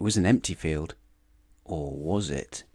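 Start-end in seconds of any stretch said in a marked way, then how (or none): 1.6-2.6 soft, slow ominous question; suspenseful. A narrator's reading. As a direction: drop low and slow for suspense. Suspenseful aside.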